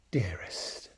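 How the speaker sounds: low, whispered tenderness; very gently. A narrator's reading. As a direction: breathe the word softly like a loving lion. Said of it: "said Aslan very gently".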